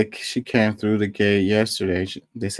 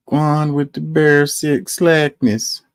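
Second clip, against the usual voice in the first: a hesitant, half-hearted try at a Jamaican accent, higher and slow; mock Jamaican accent on "Guan with the bare sick slackness", pitched up and drawn out; attempted Jamaican accent; 7 semitones higher, 3 dB louder, much slower than usual. Shown as a demonstration. Vlogger tries a comment in a Jamaican accent, then gives up. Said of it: "I wish I could say it in a Jamaican accent".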